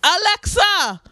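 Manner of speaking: a hint of the aunt shouting commands at Alexa; aunt calling Alexa, drawn out; aunt talking to Alexa. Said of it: "the Alexa and her".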